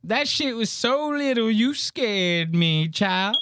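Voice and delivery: high-pitched drawn-out mock Southern sass; mimicking heckling woman. Comic repeats Miss Pat's jab at James, high-pitched mimicry.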